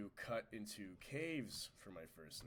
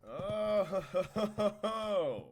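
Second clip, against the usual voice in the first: laughing; 10 semitones higher, 9 dB louder, slower than usual. Real, not acted. Laughing at own mishap.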